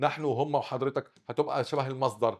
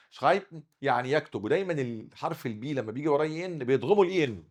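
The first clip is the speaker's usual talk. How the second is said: ordinary talk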